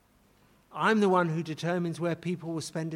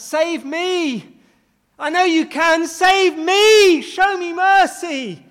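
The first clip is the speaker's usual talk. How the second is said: higher, louder pleading shout, slow on the last words; desperate cry; 10 semitones higher, 12 dB louder, slower than usual. Retold or imitated. Repentant thief crying out to Jesus, loud desperate plea. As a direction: cry out to be saved. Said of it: "this thief cried out".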